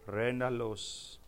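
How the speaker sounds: slow, drawn-out tongues; flowing chant-like syllables, long-held; praying in tongues. Real, not acted. Preacher breaking into prayer mid-sermon, slow tongues.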